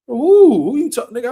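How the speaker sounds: sudden loud, high "Who?"; angry shout. Retold or imitated. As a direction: bark it back, ready to fight. Angry retort at heckler.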